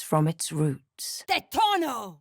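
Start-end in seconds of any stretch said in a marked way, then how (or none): 1.3-2.2 shouted high and sharp; a commanding battle cry; spell shout. Acted. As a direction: hurl the spell word out. Casting a spell.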